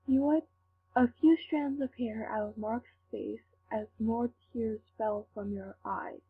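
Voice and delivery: usual speaking voice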